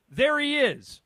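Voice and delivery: loud, high, happy recognition; excited greeting. Acted. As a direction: call it out with delight. Greeting a friend in ad.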